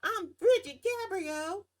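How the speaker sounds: mock her with a drawn-out high Southern twang; Brigitte Gabriel's twang. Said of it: "I'm sure she says with a twang".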